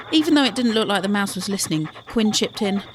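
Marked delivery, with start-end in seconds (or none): none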